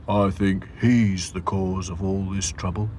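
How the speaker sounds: lightly voiced wary doll; Sad Sack suspicious. A narrator's reading. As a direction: suspect the puppy. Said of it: "thought Sad Sack".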